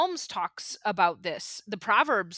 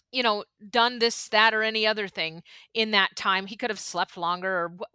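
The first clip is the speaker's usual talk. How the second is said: normal talking voice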